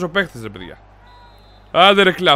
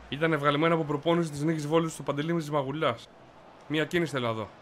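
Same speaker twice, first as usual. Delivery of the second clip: soft, lowered voice; low, hushed commentary; 4 semitones lower, 14 dB quieter than usual. Real, not acted. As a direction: murmur your verdict after the excitement. Low commentary after the big play. (χαμηλόφωνο σχόλιο)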